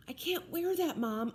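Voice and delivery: high, pleading whine; whining. A narrator's reading. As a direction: whine it like a sulky kid. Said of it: "I whined".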